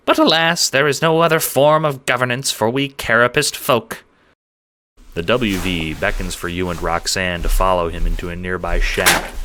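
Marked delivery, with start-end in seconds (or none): 0.0-4.0 high, squeaky voice, tired and fed up; a small creature complaining about endless wars; squeaky little man, weary. A narrator's reading. Farmer lamenting his ruined fields to strangers, squeaky.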